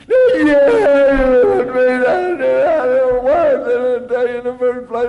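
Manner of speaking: loud, high wail through the words; hammed-up sobbing. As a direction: bawl out a confession, hugely exaggerated. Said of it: "Could not stop sobbing".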